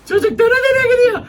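amused squeal. Real, not acted. Kid tumbling on screen.

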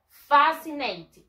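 FAscinated, slow with a strong FA; deliberate "FA-si-nay-tid"; stress on first syllable. Shown as a demonstration. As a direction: lean hard on FA of "fascinated". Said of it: "La mayor fuerza de voz".

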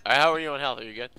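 normal talking voice

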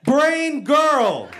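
high, loud, drawn-out repeat; mock yelling. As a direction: push each word at mom to get through. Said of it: "You're just yelling at each other".

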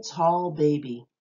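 ordinary talk

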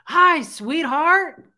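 big loud gravelly greeting, pitched high and drawn out; Fred's raspy voice. Retold or imitated. Widow imitating his sweetheart greeting from her dream, raspy and loud. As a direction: boom it out, slow and raspy. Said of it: "I hear his raspy, gravelly voice".

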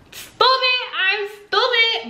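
high, slow mock wail of stupid; playful high-pitched self-mockery; mock self-scolding. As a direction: whine it out high and drawn. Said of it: "wanted everyone to know that I'm".

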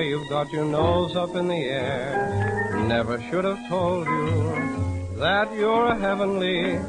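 usual speaking voice

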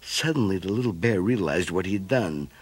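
ordinary delivery

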